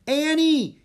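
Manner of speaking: high, loud call of her name; boy calling out for his sister, loud and high; shouted call. Acted. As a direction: shout for your lost sister in panic. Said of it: "he shouted".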